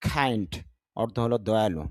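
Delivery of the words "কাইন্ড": কাইন্ড with a breathy k, like খাইন্ড; aspirated k. Shown as a demonstration. Teacher shows the aspirated k in কাইন্ড. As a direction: puff of air on the k of কাইন্ড. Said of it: "ক থেকে খ হয়ে যায়".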